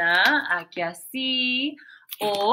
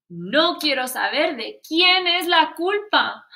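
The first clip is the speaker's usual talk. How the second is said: cross teacher; 5 semitones higher, 8 dB louder than usual. Acted. Teacher scolding class.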